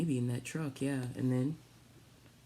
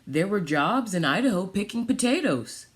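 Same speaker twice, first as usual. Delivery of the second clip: storybook reading; 5 dB louder than usual. Acted. Reads picture book aloud.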